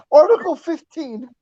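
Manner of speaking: high, slow, laugh-choked words. Real, not acted. Buddy repeating Top's threat, laughing. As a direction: squeeze the punchline out through laughter.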